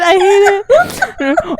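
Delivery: real laughing groan, high and drawn out; laughing cry. Real, not acted. Reacting to the cringey movie line with a real laughing wail.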